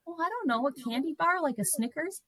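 lightly voiced shy patient ordering a snack; timid request. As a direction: ask shyly for a candy bar. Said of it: "And I'm like".

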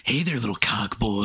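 put-on creepy caller voice; Ken Kniff. Imitating a caller for his friends, sleazy put-on voice. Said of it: "sound like Ken Kniff".

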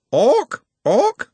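high, loud screech, repeated; bird-like cry of alarm; squawking bird creature. Acted. The sisters attack Nut, screeching.

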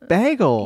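bagel high and drawn out with a short "bag" vowel; insistent odd bagel. Shown as a demonstration. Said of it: "say bagel again".

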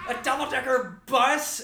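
high, held-back mock yell; imitated yell. Storyteller mimics the actor's wide-eyed yelling, high but softened mock yell. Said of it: "when he yells".

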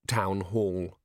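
plain reading voice

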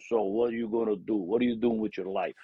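imitating a father's broken immigrant English; a dad's broken accent, done lightly and a bit teasing. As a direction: lightly voice dad in his heavy accent at the dinner table. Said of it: "with a broken accent".

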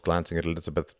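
ordinary delivery, nothing marked

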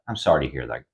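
low, soft, deadpan sympathy; flat nurse. Retold or imitated. Nurse giving Carly empty sympathy, low and soft. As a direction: mumble it low like a bored nurse.

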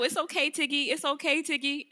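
put-on high, loud cheerful baby talk to a dog; reassuring dog talk. Shown as a demonstration. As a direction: lift the pitch and reassure the pup. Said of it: "you gotta let her know".

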